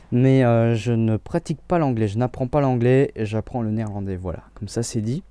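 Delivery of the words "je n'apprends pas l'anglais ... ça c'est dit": usual speaking voice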